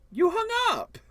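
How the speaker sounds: high, loud shocked outburst; indignant surprise. Acted. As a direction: exclaim in disbelief. Shocked caller cut off.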